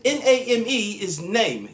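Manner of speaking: loud, high letter-by-letter spelling. Retold or imitated. Baffled customer checks with the agent, loud high spelling. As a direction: spell it out forcefully to check the meaning. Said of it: "Is that what you're meaning?".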